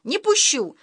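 high, sharp shout; mum shouting. A narrator's reading. Mum shouts at dad near the fridge. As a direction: cry out to stop him. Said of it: "крикнула мама".

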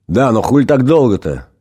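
irritated outburst; displeased. Acted. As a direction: snap at the late demon. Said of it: "с недовольством прервал его ангел".

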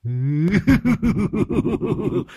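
ghost's high taunting cackle, rising; high mocking cackle. Ghost taunting the exorcist with a high cackle.

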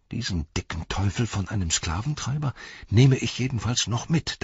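tense thought, held in without shouting; inner thought. A narrator's reading. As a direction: vow it silently to yourself. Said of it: "dachte er".

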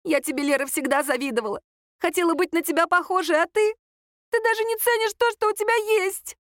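high voice climbing to indignant peak; aggrieved reproach. A narrator's reading. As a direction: build from hurt confession to accusation. Tearful rival's envy peaks in reproach.

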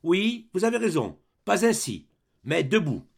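a veteran rattling off his record at attention; proud military roll call of battles; soldier reporting for duty. Acted. Grenadier standing at attention before the duke, proud report. Flambeau retorts.

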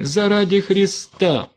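высоко и медленно, с надрывом; моли на коленях, срываясь на вопль; вопль мольбы. A narrator's reading. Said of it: "падая на колени". (высокий умоляющий вопль)

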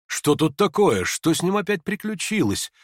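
slightly high, inquisitive buzz; curious onlookers. A narrator's reading. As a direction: ask it like a puzzled bystander. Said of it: "спрашивали кругом".

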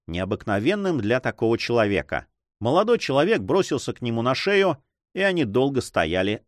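ordinary delivery

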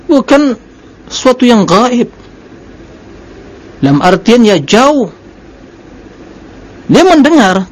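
high, insistent sermon delivery; emphatic preaching. Real, not acted. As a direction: drive the point home in a high, ringing preacher's pitch.

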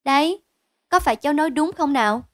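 high, loud, self-satisfied youngster; smug child. Acted. Child boasts to the men that the guess was correct, smug child. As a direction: crow proudly like a child proved right. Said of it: "Cô Diệu tự phụ".

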